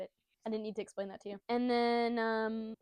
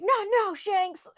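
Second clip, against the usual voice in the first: put-on high, loud kid protest; Luffy; 10 semitones higher, 11 dB louder, much slower than usual. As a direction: yell in flustered cartoon protest. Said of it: "Luffy's like".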